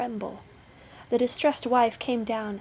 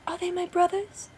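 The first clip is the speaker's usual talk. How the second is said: high, eager question; hopeful wife; 9 semitones higher than usual. A narrator's reading. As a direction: ask your sister in hope. Wife hopes for rescue.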